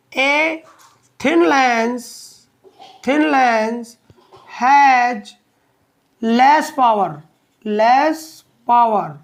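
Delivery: slow, spaced-out words with long pauses; dictation. Real, not acted. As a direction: dictate slowly, repeating for the note-takers. Dictating the third note point. (एक-एक शब्द धीरे-धीरे लिखवाते हुए)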